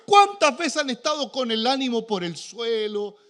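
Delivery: ordinary talk, nothing marked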